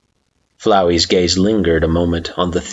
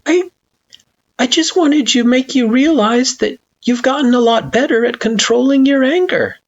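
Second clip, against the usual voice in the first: awkward; 3 dB louder than usual. Acted. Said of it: "Frisk shifted awkwardly".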